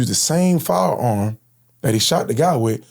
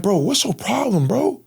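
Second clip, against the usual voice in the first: angry confrontation; 10 semitones higher, slower than usual. Retold or imitated. Confronting the gunman.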